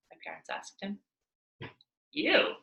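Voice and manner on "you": dismissive question. Acted. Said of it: "Mister Yao asked".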